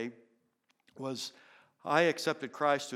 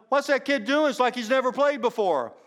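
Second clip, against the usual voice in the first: much higher, louder astonished yell; coach yelling; 10 semitones higher, 10 dB louder than usual. Retold or imitated. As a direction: shout across the field in disbelief. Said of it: "The coach is yelling".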